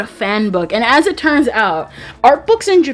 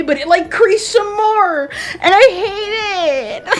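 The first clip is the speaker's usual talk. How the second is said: frantic; 9 semitones higher than usual. Real, not acted. Complaining about crease.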